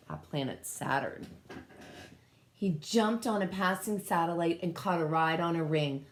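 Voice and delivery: usual speaking voice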